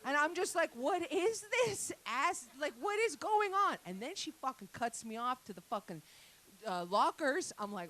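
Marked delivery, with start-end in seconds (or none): 0.7-3.8 high, slow, loud disbelief; mock shock. Comic reacting to the gym woman, high slow disbelief. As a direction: gape at it, drawn out and high.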